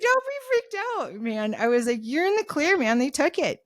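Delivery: high-pitched retold reassurance; upbeat, high all-clear; animated reassurance. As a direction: reassure a friend in a lifted, lively voice. Retells reassuring freaked-out friend.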